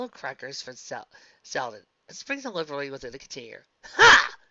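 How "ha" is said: high loud triumphant "Ha"; loud shrieked ha. Acted. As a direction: shriek a mocking laugh. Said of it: "Derek shrieked".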